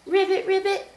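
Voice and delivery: high-pitched little frog ribbits. Shown as a demonstration. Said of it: "What's a frog say?".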